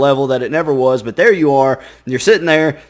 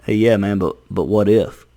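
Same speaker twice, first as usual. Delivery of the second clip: lower, sly voice of a nagging brain; sly inner voice; 5 semitones lower than usual. Retold or imitated. Brain nagging the sleepless person, low sly voice. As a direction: tempt with a doubt. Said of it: "your brain does that thing where it goes".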